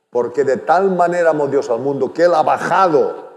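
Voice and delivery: preacher rising to a climax; full force preaching. Acted. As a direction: drive the point home to the congregation.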